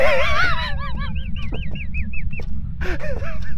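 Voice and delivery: high, squealing burst of laughter; shrieking laugh. Real, not acted. Laughing at the ghost death, high shrieking laugh. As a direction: let a shrill laugh rip.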